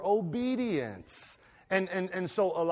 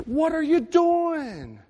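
high, pleading voice of God; high loud divine appeal; God speaking; 9 semitones higher, 8 dB louder than usual. Acted. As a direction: cry out as God, hurt and urging. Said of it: "and he says".

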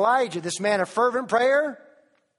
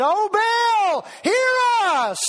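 high, loud imitating shout to Baal; mock frantic yell; 12 semitones higher, 4 dB louder, much slower than usual. Preacher mimicking Baal's prophets screaming at the altar, loud mock yell. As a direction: ham up the false prophets crying out. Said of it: "who yelled and screamed and said".

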